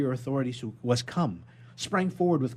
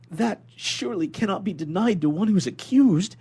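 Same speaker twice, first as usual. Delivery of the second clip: higher, louder rising desperation; impassioned plea; 7 semitones higher, 7 dB louder than usual. Acted. Prisoner pleading with the inspector for a trial, higher and louder. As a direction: pour out the whole injustice.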